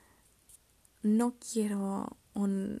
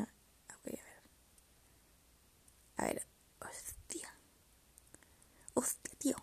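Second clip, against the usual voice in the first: whispered muttering to herself, soft and breathy; frustrated whisper; 5 dB quieter than usual. Real, not acted. Vlogger alone with her phone, can't find the PDF, whispered frustration. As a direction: mutter under your breath while searching.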